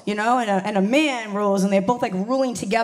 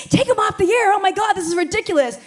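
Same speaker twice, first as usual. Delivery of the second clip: mock outraged hashtagger; 7 semitones higher, 3 dB louder than usual. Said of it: "be like".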